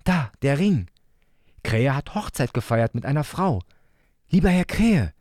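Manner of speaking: hohe, sanft zuredende Kinderstimme; fürsorglicher kleiner Bruder. Acted. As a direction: liebevoll zur Krähe sprechen wie ein Kind. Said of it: "sagte Leo fürsorglich". (hohe, fürsorgliche Kinderstimme)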